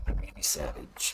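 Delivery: low, slow, gravelly-free wrestler growl in a hushed voice; lightly done Macho Man voice; Randy Savage impression. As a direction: drop way down like a wrestling promo. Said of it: "My Randy Savage impersonation?".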